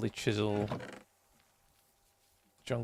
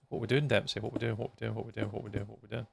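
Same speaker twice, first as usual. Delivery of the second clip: rapid-fire repeats growing softer; fading repetition; 2 semitones lower, 7 dB quieter, faster than usual. Real, not acted. Streamer pestering a friend about plans, fading repetition. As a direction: start near normal, repeat it ever quieter.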